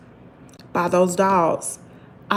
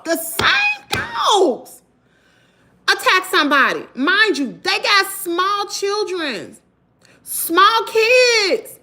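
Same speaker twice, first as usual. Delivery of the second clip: high-pitched, heated real anger; heated rant; 11 semitones higher than usual. Real, not acted. Vlogger ranting at the neighbor over the dangerous dogs, high and heated. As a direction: rail at the careless owner, voice up high. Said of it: "let me calm down".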